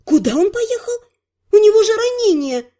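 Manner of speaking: high-pitched shocked protest; alarmed disbelief. A narrator's reading. Heroine protests that Terry is wounded, alarmed. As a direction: voice leaps up in dismay.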